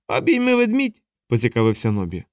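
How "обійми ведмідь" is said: voiced as Nobby. A narrator's reading. Nobby voiced, high put-on voice.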